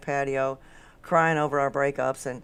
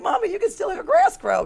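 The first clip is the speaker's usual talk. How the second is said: put-on high, loud child's protest; pitched-up mock kid voice; imitating own kid; 8 dB louder than usual. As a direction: whine it up high like a kid to mom. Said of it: "My kid's like".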